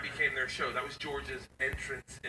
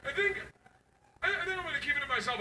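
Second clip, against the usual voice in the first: put-on higher, sheepish teen girl voice; daughter's voice; 11 semitones higher, faster than usual. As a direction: play the embarrassed daughter, higher and quick at the end. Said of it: "And she goes".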